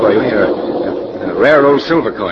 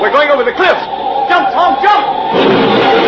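high, very slow terrified yell; panicked scream; 13 semitones higher, slower than usual. Acted. Passenger screaming at the driver to jump. Car going over cliff.

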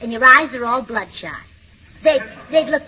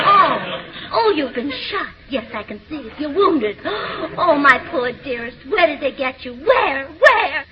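overwrought stage heroine, swooping and gushing; hammy melodrama. Acted. Wife rehearses a scene with her husband, hammed-up melodrama. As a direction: play the tearful wife far too big. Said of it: "give it everything you've got".

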